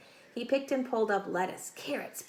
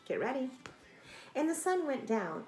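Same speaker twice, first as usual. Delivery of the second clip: ordinary delivery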